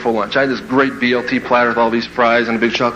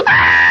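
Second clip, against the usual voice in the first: loud low mock scream; imitated scream; 10 semitones lower, 5 dB louder than usual. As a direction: let out a hammed-up scream. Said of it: "and then I scream".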